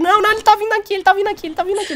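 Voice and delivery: high, loud panicked cry; panicked shouting. Real, not acted. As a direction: squeal as it comes closer. Panic as zombie approaches.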